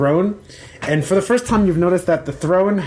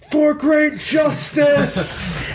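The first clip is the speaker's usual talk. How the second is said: high, long drawn-out shout; battle cry; 13 semitones higher, much slower than usual. Acted. A voice breaks into the execution, high shouted battle cry. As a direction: stretch each word into a rallying yell.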